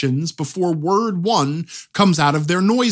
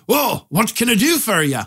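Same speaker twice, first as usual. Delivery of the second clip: stock Scottish blacksmith, pitched up; Scottish accent; 4 semitones higher than usual. Acted. Blacksmith repeats his greeting to the player, Scottish accent. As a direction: greet the customer in a put-on Scots brogue. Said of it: "They put on a Scottish accent".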